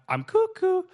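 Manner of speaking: admitting he is crazy, in a goofy squeak; squeaky cuckoo. Retold or imitated. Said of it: "I know I".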